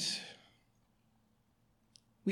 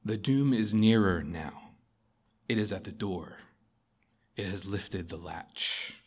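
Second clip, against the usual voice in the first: quiet doom; 5 semitones lower, 7 dB quieter than usual. A narrator's reading. Lion warning the raging prince, quiet and grave. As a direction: let each word fall softly. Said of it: "said Aslan quietly".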